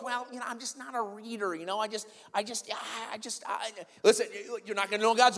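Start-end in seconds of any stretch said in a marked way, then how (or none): 0.0-3.7 higher, softer, stammering mock excuse; hedging excuse. Preacher playing a church member who won't read, mock hedging. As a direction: imitate someone feebly wriggling out of it. Acts out a reluctant Bible reader's excuse.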